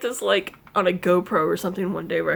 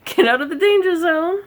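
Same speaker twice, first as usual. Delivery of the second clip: high, louder mock panic; mock alarm; 7 semitones higher, 5 dB louder than usual. Imitating herself shooing the cat off her chair, mock alarm. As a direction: playfully shoo the cat away. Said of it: "and I'm like".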